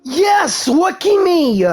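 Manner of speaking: ordinary talk, nothing marked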